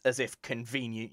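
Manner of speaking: normal talking voice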